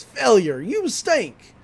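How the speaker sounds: lightly imitated skeleton cowboy. Pinball machine taunts the losing player, lightly voiced cowboy jeer. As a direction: punch the first word, pitch up on the insult. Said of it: "a cowboy voice".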